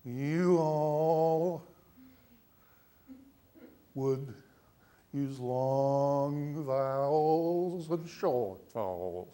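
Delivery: very slow, drawn-out Southern drawl; Southern accent. Shown as a demonstration. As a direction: stretch each vowel in a put-on Southern accent. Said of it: "if you speak with a Southern accent".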